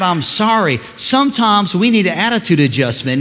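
loud, high, driving sermon delivery; full-force preaching. Real, not acted. Pastor urges church to obey God, impassioned loud preaching.

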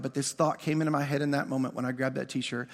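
normal talking voice

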